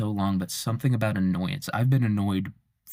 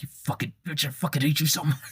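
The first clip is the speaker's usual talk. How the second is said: pitched-up mock rage, half laughing; hammed-up fury at an imagined woman; mock furious outburst; 6 semitones higher than usual. Man imagining an argument, pitched-up mock rage. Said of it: "and you'd be like".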